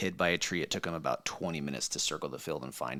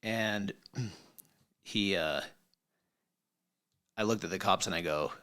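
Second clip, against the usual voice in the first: usual speaking voice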